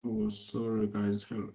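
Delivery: ordinary talk, nothing marked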